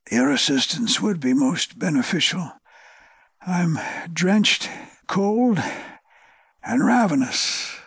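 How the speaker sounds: weak, exhausted raspy voice; weak rasp. A narrator's reading. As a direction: barely rasp it out, worn down. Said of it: "A weak, exhausted voice barely managed to rasp".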